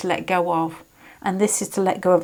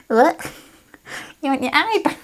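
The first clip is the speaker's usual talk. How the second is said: a bad, higher-pitched Australian doctor; a playful, botched Australian twang; mock Australian accent; 7 semitones higher than usual. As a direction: lark about in a clumsy Australian accent. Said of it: "excuse my Australian accent".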